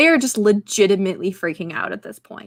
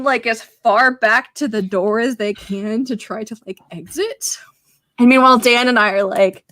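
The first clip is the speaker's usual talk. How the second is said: normal talking voice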